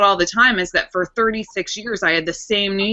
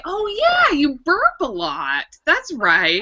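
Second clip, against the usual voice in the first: mock surprise; 8 semitones higher, much slower than usual. Retold own reaction.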